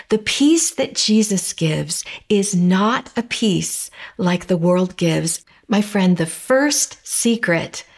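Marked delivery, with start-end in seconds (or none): none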